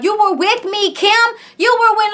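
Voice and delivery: high-pitched mock innocence; acting innocent. Tracy protesting to Kim, imitated innocence. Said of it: "trying to act like she did nothing wrong".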